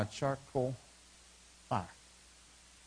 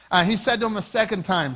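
ordinary talk, nothing marked

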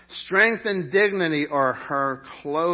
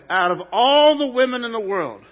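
louder and higher declaration; joking boast to wife; 6 semitones higher, 9 dB louder than usual. Pretend. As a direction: shout it playfully to your wife. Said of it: "I get to tell my wife".

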